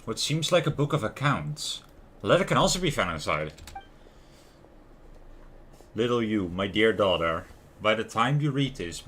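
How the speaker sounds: normal talking voice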